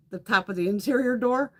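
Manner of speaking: usual speaking voice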